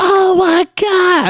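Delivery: very high, drawn-out wail of dread; mock panic. Teen caught drunk by friend's dad, mock panic. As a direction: stretch it out high, hammed-up panic. Retold panic at the dad's call.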